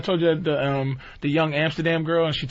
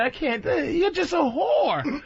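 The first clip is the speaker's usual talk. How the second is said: mock exasperated retort; 9 semitones higher, much slower than usual. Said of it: "and I said".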